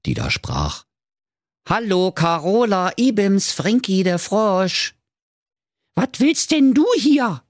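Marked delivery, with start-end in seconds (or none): 1.7-4.9 high singsong frog greeting; Frankie der Frosch. Acted. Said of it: "vernahm sie erneut eine Stimme". 5.9-7.4 very high, loud indignant princess; shrieky, affronted question. A narrator's reading. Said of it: "fragte da die schöne Carola Knackwurst empört".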